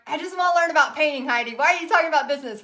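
teasing impression of a puzzled viewer; mock viewer complaint. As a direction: rattle it off like an impatient fan. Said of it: "you're like".

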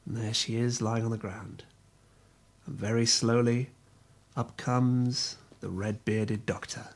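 soft, low, ominous narration; hushed storytelling. A narrator's reading. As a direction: draw them in with a hush.

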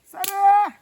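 calling out. Real, not acted. Calling the dog.

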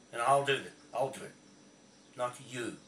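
loud, furious vow; shouting the threat straight at him; angry outburst. Real, not acted. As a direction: bark it out, fed up and pissed off. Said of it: "I'm saying it like that because I'm pissed off".

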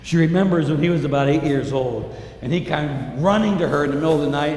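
usual speaking voice

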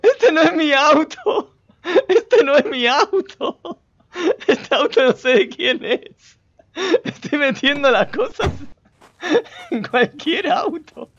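high, slow wail through laughter; helpless laughing. Real, not acted. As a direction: barely get the words out for laughing. Laughs helplessly at own blunder.

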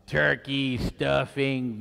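ordinary talk, nothing marked

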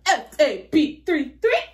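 hammed-up pep squad chant; cheerleader. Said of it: "I sound like a cheerleader".

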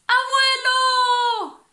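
very high, loud, drawn-out call; shouted. Acted. Little toad shouting to grandpa, high, loud and slow. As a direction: cry out to grandpa bravely. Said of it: "gritó".

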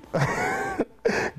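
a short, high burst of laughter; amused laugh. Real, not acted. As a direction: laugh at how fast God tested him. Laughs at his own story.

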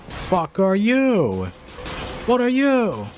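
high, drawn-out startled wail, climbing; alarmed disbelief. Real, not acted. Player meeting a strange monster, alarmed disbelief. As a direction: stretch the question out high in alarm.

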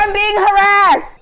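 high, loud, slow wail; hammed-up victim wail; mock outrage.

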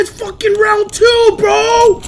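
high loud angry complaints; frustrated yelling. Real, not acted. Gamer raging that his button didn't work, angry yelling. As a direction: rage at the game.

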